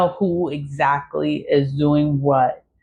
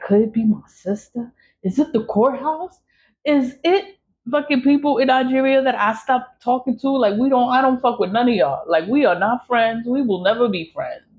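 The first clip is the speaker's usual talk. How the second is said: frustrated inner rant; 6 semitones higher than usual. Retold or imitated. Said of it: "So I'm like".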